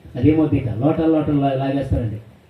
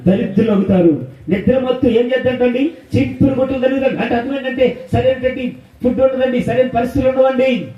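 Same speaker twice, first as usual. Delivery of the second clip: fiery preaching; 9 semitones higher than usual. Acted. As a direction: preach it hard to the congregation. Preaching against drinking. (ఉద్వేగభరితమైన ప్రసంగం)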